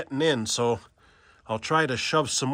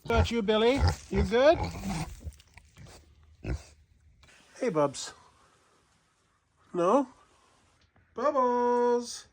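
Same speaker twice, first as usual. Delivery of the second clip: pet-talk voice; 6 semitones higher than usual. Real, not acted. Cooing to the animals.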